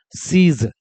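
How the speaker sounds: सीज with a long ई and a soft final ज; teacher says सीज quietly and clearly; model pronunciation. Shown as a demonstration. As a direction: demonstrate सीज: long सी then ज. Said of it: "तो देखो क्या बन गया?".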